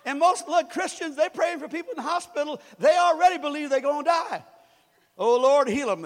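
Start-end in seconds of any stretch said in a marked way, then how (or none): 5.2-6.0 loud, hammed-up pleading; mock pious prayer. Pastor mocking Christians praying in hospitals, loud. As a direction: ham up a holy plea.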